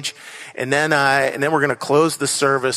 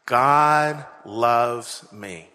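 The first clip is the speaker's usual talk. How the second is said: congregation saying it together; 4 semitones lower, much slower than usual. Real, not acted. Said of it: "And one more time".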